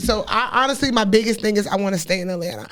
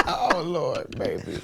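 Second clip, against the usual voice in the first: laughed-out sigh; 6 semitones lower, 8 dB quieter, slower than usual. Real, not acted. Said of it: "I'm trying not to cry".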